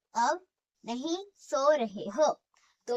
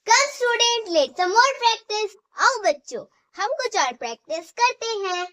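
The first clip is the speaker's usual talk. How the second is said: loud and high, calling the class to practise; rallying call to class; 7 semitones higher, 9 dB louder than usual. Real, not acted. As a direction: cheer the students on to the next exercise. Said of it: "teacher rallying class".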